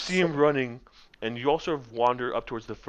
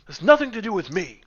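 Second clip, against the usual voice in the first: high, loud indignant yelling; a fussy wizard furious at being pinned; the wizard yelling at Will to let go, high and loud; angry wizard; 8 semitones higher, 8 dB louder than usual. Acted. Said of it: "He goes".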